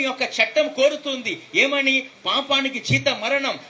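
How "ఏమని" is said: ordinary delivery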